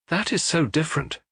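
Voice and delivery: a lightly voiced lofty dismissal. Pauline to Flambeau, mildly lofty and dismissive. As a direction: say it from above, brushing him off. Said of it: "said Pauline Stacy loftily".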